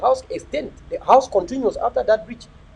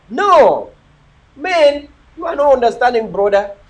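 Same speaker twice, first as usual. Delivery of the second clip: loud protest; 4 semitones higher, 7 dB louder, slower than usual. Real, not acted. Protesting commenter's claim.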